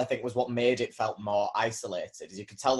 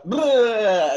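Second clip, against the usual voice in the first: loud silly bleh; 11 semitones higher, 10 dB louder than usual. Pretend. Acting out messing up the setup.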